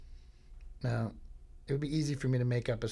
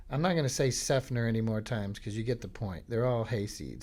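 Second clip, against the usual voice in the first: normal talking voice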